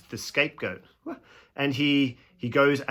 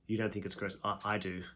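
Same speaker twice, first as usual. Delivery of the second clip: quiet earnest God; 3 semitones lower, 6 dB quieter than usual. Retold or imitated. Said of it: "And God goes".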